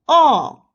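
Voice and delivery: "oh" loud, falling from high. Shown as a demonstration. Teacher leads repeat drill, falling oh. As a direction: drop "oh" from the top. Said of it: "The fourth tone is the falling tone".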